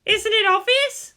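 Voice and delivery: put-on a proud girl cursing to herself; teenage girl's inner voice. Pilot girl fuming inwardly over the boy.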